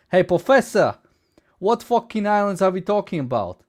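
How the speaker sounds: put-on brash New Jersey accent, shouting the question. New Jersey student heckling his professor, loud New Jersey accent. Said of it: "Is that a New Jersey accent?".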